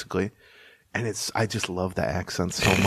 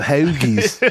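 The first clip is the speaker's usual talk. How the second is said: imitated Philly accent on "hoagies". Joking about people who talk about hoagies, Philly accent. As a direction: say "hoagies" like a Philadelphian. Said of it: "I love the Philly accents".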